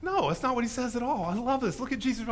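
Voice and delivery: normal talking voice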